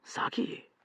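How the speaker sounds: soft unvoiced name; murmured. Omar murmuring the name to himself, hushed. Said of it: "murmured Omar".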